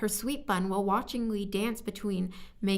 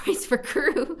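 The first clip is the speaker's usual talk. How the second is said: laughing question; 6 semitones higher, 6 dB louder, slower than usual. Real, not acted. Laughs at the odd phrase.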